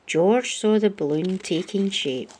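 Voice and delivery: ordinary delivery, nothing marked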